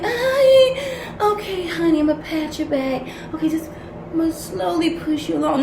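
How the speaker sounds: high, soft mocking baby-talk of a gentle counselor; mock soft coddler. Reader mocking gentle counselors, high cooing put-on voice. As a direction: sneer at soft-hearted helpers by cooing. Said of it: "people do things too soft".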